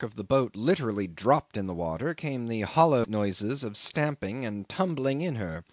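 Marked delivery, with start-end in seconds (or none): none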